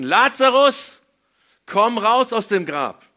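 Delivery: full-voiced, high shout with drawn-out words; geschrien. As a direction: bellow a command into the tomb. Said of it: "er hat geschrien". (lauter, hoher, gedehnter Schrei)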